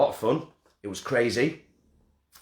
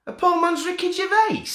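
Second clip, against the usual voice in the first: the same phrase squealed much higher and louder; gleeful squeal; 4 dB louder than usual. Shown as a demonstration. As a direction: squeal it out, thrilled with the nickname. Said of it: "I'm having that".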